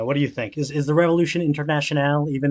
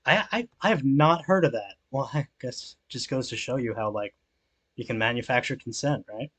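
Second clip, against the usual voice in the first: normal talking voice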